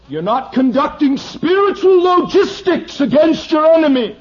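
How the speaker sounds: high, loud, slowed warning; preaching at full force. Real, not acted. Preacher warning his flock against the enemy, loudly. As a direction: proclaim it high and strong.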